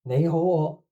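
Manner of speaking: ordinary talk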